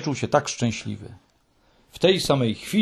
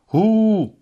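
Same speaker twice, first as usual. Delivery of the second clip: high, loud drawn-out "hoo"; high hallooing shout; hooted call; 9 semitones higher, 5 dB louder than usual. A narrator's reading. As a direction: call out a long hoot from afar. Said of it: "krzyknęła Too Tiki".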